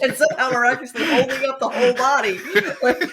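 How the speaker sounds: words bubbling through real laughter; cracking up while describing the absurd scene; laughing. Real, not acted. Laughing at absurd body-carrying image.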